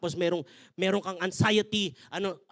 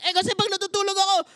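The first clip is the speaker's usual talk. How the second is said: higher, nagging wife's voice; complaining wife; 8 semitones higher, 5 dB louder than usual. Retold or imitated. Pastor acting his wife's complaint in their quarrel, high and nagging. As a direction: whine out her grievance like the wife.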